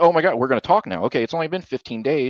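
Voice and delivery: high, fast sarcastic mock of the ex; sarcastic imitation of the ex finally talking. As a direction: rattle it off with fake cheer. Mocking ex after silent treatment.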